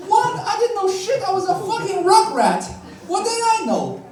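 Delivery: high, incredulous pleading; indignant protest. Retold or imitated. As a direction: squawk it back in disbelief.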